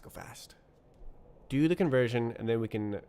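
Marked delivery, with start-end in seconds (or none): 0.0-0.5 whispered to self. Real, not acted. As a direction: breathe it out unvoiced. Self-urging repeated.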